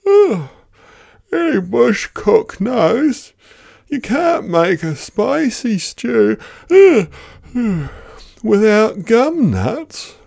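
high, slow, drowsy drawl; drowsy know-all bush cook; high sleepy Koala. Acted. Sleepy Koala advises on gum nuts.

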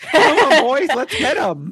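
high, loud, slow war cry; rallying battle cry. Pretend. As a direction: charge the troops into battle. Rallying duck troops into battle.

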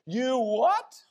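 a disbelieving mom's sharp "you what"; startled mom. Retold or imitated. Mom reacts to crash news.

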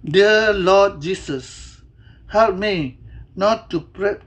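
ordinary delivery

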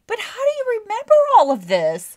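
high, loud incredulous question. Retold or imitated. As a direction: demand it in loud disbelief. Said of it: "And I'm like".

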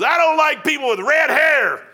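high, loud retold shout; yelling. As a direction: yell it right up into his chin. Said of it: "started yelling".

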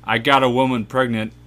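loud laughing confession; laughing shout. Real, not acted. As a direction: blurt it out laughing. Confession blurted while laughing.

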